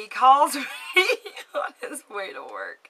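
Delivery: high, loud laugh breaking into words, then subsiding; real giddy laughing. Real, not acted. Woman telling of the man's call today, laughing giddily.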